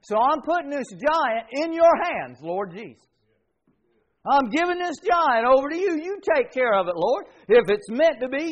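fervent prayer. Retold or imitated. Believer handing the giant to the Lord, fervent. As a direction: pray it out with fervour. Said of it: "that's a legitimate prayer".

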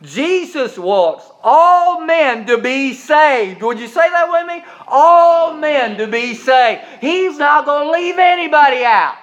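slow, high, loud with all stretched; preaching at full force. Acted. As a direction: lead the congregation in the line. Preaching, call to repeat.

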